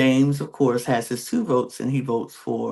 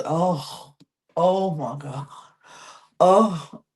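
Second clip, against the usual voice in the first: imitating distraught gasp; 4 semitones higher, slower than usual. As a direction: act out James hearing the bad news. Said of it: "He's just".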